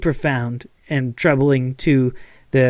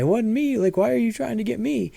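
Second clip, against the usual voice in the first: higher, fast, whiny "it wasn't me" voice; mock blame-dodger; 8 semitones higher, faster than usual. Preacher mimics someone dodging blame, high and hurried. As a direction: play the guilty man pointing fingers, high and quick. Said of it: "or saying".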